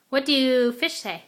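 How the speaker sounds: ordinary delivery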